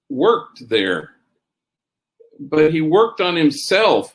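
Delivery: ordinary delivery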